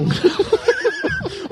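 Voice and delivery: high-pitched giggle; a quick high laugh. Real, not acted. Laughs after joke translation.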